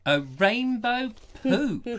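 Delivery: slow, high mock disbelief; disbelieving. Real, not acted. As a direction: echo it in comic shock. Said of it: "incredulous echo".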